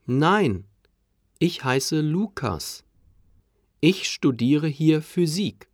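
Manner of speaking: ordinary talk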